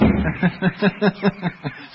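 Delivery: high, loud run of laughter; amused laugh. Acted. Grown-up amused by the children, laughing heartily. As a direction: burst out laughing, long and merry.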